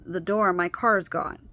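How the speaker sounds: ordinary talk, nothing marked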